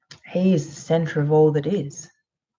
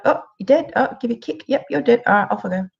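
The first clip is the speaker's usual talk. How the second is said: high, breezy uncaring voice shrugging off a death; mocking the ex's coldness in a light, higher tone; callous narcissist; 4 semitones higher, faster than usual. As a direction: play the narcissist as cheerfully indifferent. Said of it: "They'll be like".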